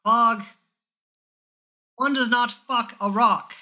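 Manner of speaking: put-on high, exasperated old man scolding. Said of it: "And the old man says".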